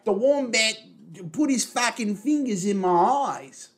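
loud mock Australian bloke calling out; Australian accent. Friend keeps mocking Kano, loud hammed-up Australian accent. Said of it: "really fucking Australian".

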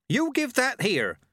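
put-on high, slow shout from the old witch; Nanny Ogg shouting, grabbing. A narrator's reading. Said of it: "shouted Missus Ogg".

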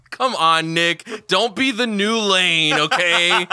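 loud, high, drawn-out urging; teasing heckle. Pretend. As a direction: goad him loudly, stretching the words. Heckling co-host to get on with it.

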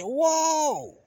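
high shouted Whoa!; alarmed shout; startled shout. Retold or imitated. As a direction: yell to stop the charging dog. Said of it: "I said".

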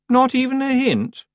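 high, slow, pressing question; prying. A narrator's reading. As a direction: fish for the answer. Anagrama pressing Tiffany.

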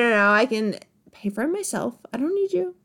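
ordinary delivery

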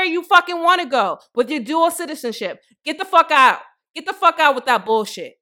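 loud heated rant. Real, not acted. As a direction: let the anger boil over. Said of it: "I was heated".

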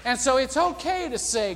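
ordinary delivery

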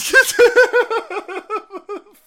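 loud, high cackling laugh; long helpless laugh at being teased; real burst of laughter. Real, not acted. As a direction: crack up loudly. Laughs at viewer's jab.

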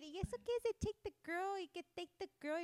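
Spanish: oyente confundida, rápida; confused listener. Pretend. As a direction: act lost at the English words. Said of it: "Va a decir".

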